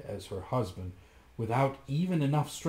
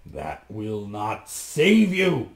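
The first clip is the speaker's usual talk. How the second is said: louder, very slow; cold fury; 2 semitones lower, 6 dB louder, much slower than usual. A narrator's reading. Bluebeard refusing his wife, slow and cold. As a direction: spit it out slowly. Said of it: "cried Bluebeard".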